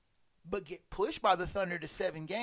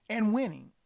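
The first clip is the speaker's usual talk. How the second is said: winning punched a bit louder, falling; stress on winning; 4 dB louder than usual. Real, not acted. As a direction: lean hard on "winning" to cap the list. Repeated phrase builds to climax.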